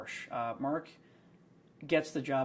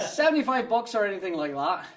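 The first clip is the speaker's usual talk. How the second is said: high, loud put-on Scottish accent; imitated Scottish customer voice; 8 semitones higher, 9 dB louder than usual. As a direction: ask brightly in a Scots burr. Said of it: "hard Scottish accent".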